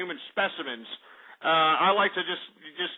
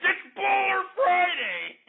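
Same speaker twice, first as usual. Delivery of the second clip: much higher, slow, yelled through laughter; laughing shout; 11 semitones higher, much slower than usual. Acted. Host cracking himself up over Baller Friday, laughing shout. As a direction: crack up while bellowing the catchphrase.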